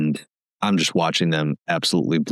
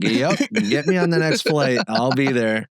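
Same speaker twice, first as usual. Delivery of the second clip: higher-pitched, lightly acted jump at the offer; mock-eager instant acceptance, pitched up; eager yes; 6 semitones higher than usual. Kicked-out bassist accepting the call to rejoin the tour, eager.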